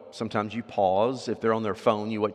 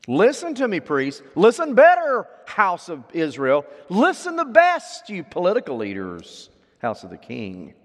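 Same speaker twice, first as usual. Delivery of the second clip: each listen louder and higher; rising intensity; 6 semitones higher, 6 dB louder than usual. Shown as a demonstration. Preacher paraphrasing Hosea, listen building louder and higher. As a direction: climb to the best. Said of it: "the hearing is a little more intense".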